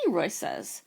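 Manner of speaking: ordinary delivery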